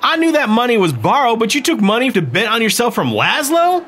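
outraged; angry disbelief. A narrator's reading. Emmeline angry at Meade.

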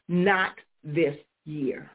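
NOT stressed, slow and firm; slow emphatic decree. Acted. Preacher decreeing over the congregation, slow and emphatic. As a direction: declare "not this year" slowly, hitting "not".

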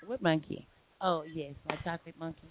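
ordinary talk, nothing marked

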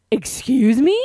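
loud, high indignant question; scandalised disbelief. Retold or imitated. Friend hears her shot was given away, scandalised disbelief. Retells outrage at shared shot.

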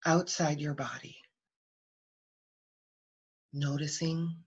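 calm body-scan voice, phrases set apart by long pauses; guided meditation. Real, not acted.

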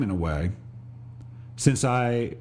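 ordinary talk, nothing marked

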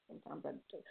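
very soft, low confession; quiet aside. Real, not acted. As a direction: drop it right down, almost to nothing. Muttered admission after a claim.

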